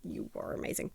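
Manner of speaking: soft, breathy whisper with no voice; whispered thanks. Real, not acted. Quietly thanking the mixer in a whisper. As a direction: whisper it like a secret compliment.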